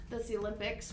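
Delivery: ordinary talk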